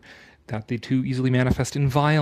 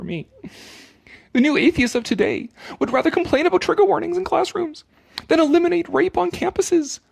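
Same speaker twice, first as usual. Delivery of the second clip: much higher, louder sanctimonious reading; mocking read; 8 semitones higher, 4 dB louder than usual. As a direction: ham up the self-righteous author. Mock-reading the essay.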